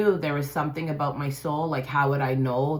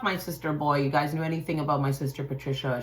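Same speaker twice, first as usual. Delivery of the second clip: ordinary talk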